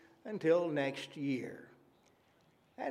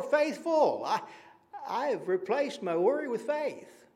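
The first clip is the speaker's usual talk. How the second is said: higher, brighter and quicker, a glad confession; joyful testimony; 7 semitones higher than usual. Retold or imitated. Churchgoer telling the congregation of lost worry, lifted and glad. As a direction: testify with rising delight.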